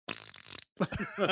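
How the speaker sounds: deep silly noise. Acted. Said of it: "Here's a human sound for you".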